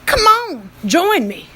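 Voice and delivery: loud, high cowboy holler; hearty cowboy accent, inviting folks over. Acted. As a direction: call it out in a cowboy drawl. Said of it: "when our cowboy said".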